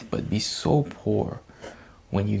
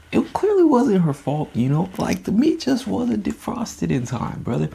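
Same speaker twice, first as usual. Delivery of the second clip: higher, louder put-on voice of the sister; mock sister voice; 10 semitones higher, 5 dB louder than usual. The sister defending her partner, in a high mock voice. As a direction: voice the sister's side, high and pleading.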